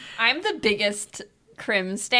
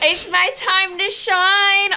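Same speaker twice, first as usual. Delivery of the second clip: excited boast; 8 semitones higher, 6 dB louder, slower than usual. Acted. Boasting about a strength contest.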